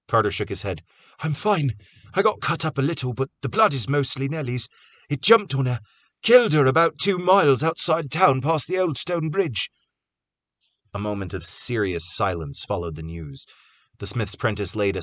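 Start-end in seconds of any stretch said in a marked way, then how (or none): 1.2-9.7 shaken. A narrator's reading. Carter telling of Nellie.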